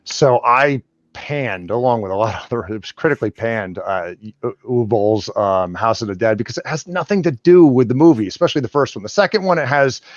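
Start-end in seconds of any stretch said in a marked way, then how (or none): none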